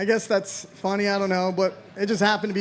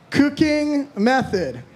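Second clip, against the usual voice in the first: drawn out, higher, baffled; slow puzzled read; 4 semitones higher, much slower than usual. Real, not acted. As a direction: stretch it out slowly, baffled. Reading a puzzling prompt.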